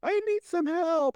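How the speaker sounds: very high, drawn-out mock child voice; hammed-up little-kid plea; panicky child. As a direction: imitate a child pleading for help. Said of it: "in a very childlike way, like, in a panicky voice".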